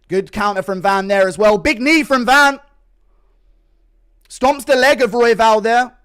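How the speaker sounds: excited fight commentary; live play-by-play. Real, not acted. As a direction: call the action live.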